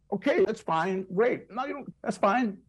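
high-pitched, unconvincing reassurance; mock politely placating. Fellow actors humoring a terrible actor, mock placating. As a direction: imitate people politely covering for a bad performance. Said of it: "those guys were like".

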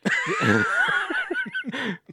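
shrieking laugh. Real, not acted. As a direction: burst out in a howling laugh. Huge laugh shout.

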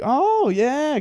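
high, gushing phone voice; exaggerated chirpy reaction; put-on enthusiasm. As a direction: imitate an over-keen call agent. Imitates call agent on speaker.

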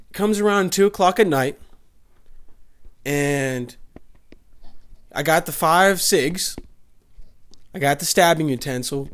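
ordinary talk, nothing marked

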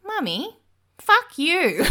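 put-on high, loud little-kid voice; toddler voice. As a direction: mimic the two-year-old proudly repeating it. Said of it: "my two-year-old looked at me and goes".

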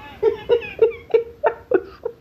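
high laugh. Real, not acted. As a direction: break out laughing. Laughing at the commentary.

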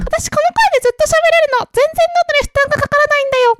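squeaky high-pitched greeting; way up high, light and thin. Shown as a demonstration.

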